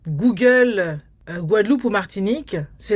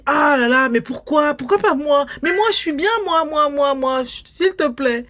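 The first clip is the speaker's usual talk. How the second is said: mock whiny complaint; 5 semitones higher than usual. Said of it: "Tu dis".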